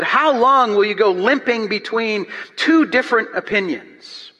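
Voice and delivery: loud, high, driving pulpit reading; preaching at full force. Acted. Preacher to the congregation, reading Elijah's challenge, booming. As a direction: proclaim it to the back row.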